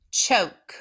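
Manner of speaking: usual speaking voice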